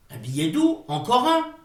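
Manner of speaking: ordinary delivery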